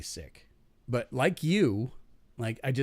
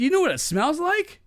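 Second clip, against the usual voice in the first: quoting himself; 6 dB louder than usual. Retold or imitated. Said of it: "I said".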